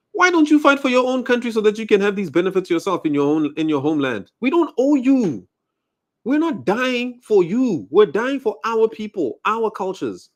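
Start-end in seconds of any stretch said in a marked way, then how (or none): 4.4-10.3 defiant declaration. Real, not acted. Telling foreigners what South Africans owe them, a slow defiant cry. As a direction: hammer it home slowly.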